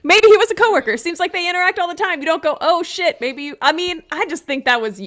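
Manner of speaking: loud, high indignant rant; mad. Real, not acted. Co-host mad at the man's useless reaction, ranting loudly. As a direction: argue back hotly, voice climbing. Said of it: "That made me mad for some reason".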